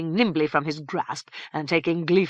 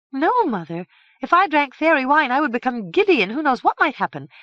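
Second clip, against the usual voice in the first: put-on higher, louder girlish voice; earnest young daughter; 9 semitones higher, 6 dB louder, faster than usual. A narrator's reading. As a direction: refuse firmly but politely.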